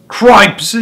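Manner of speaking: loud, high exclamation; startled cry. A narrator's reading. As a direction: react to the commotion. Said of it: "he cried".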